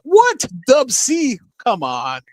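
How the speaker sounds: sudden high surprised yelp. Real, not acted. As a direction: caught off guard, delighted. Surprise at friend joining stream.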